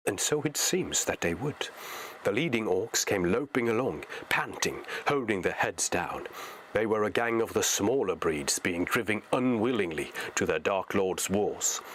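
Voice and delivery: ordinary delivery, nothing marked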